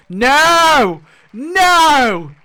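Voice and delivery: loud, high, drawn-out cry of no; shouted refusal. Acted. Vlogger refusing a character's death, shouted protest.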